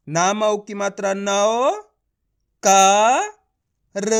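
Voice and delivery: नौ, क, र drawn out separately; letter by letter. Shown as a demonstration. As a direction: spell slowly with gaps between नौ, क and र. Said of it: "एक एक अक्षर का उच्चारण".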